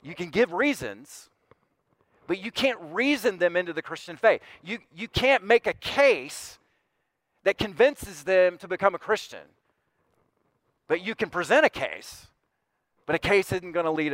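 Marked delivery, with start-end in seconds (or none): 2.4-14.1 emphatic preaching. Acted. Preacher presses his point.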